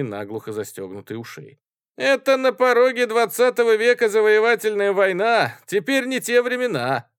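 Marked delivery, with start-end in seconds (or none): 2.0-7.1 high, loud debater's protest; heated retort. A narrator's reading. Student arguing war is outdated, heated. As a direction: fire back at full pitch.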